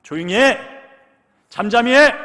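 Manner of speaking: loud, high scolding yell; rebuking shout. Retold or imitated. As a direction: shut him up, hard. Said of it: "꾸짖었답니다".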